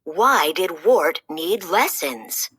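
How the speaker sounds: ordinary delivery, nothing marked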